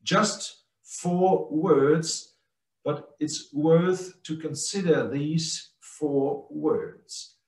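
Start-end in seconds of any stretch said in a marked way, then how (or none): none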